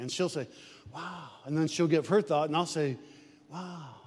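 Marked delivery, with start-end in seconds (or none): 0.9-1.3 hushed wow. Retold or imitated. Wife's quiet awed reaction. 3.5-3.9 hushed wow. Retold or imitated. Husband echoes awed reaction.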